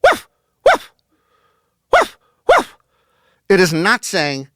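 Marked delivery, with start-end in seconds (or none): 0.0-2.8 barked woof noises, loud and short; imitated dog bark. Shown as a demonstration. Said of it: "when a dog barks".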